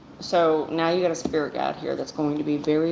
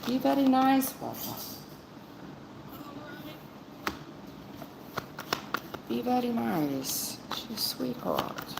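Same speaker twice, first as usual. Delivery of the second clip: softly voiced spirit-guide plea; relaying spirit guide; 6 semitones higher, 6 dB quieter than usual. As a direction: pass on the guide's plea gently. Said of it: "They say you a sweetheart".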